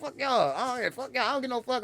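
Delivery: very high, indignant outburst; high-pitched mock fury at normal volume; retold anger. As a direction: act out lashing back at the booers. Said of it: "I'm mad".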